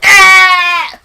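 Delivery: loud, high death yelp; sudden mock death cry; dying kid scream. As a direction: shriek like he's been stabbed. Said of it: "and he goes".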